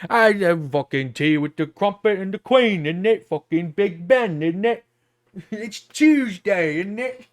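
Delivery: loud, hammed-up British caricature; shit British accent. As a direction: do a badly faked British accent, drawing out the ends. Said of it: "That's such a shit British".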